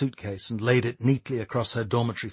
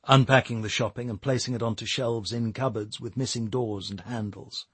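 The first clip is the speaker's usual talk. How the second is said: plain reading voice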